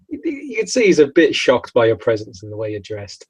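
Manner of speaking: ordinary delivery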